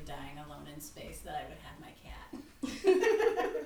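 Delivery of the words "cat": laughing whisper. Real, not acted. Laughing at her own idea.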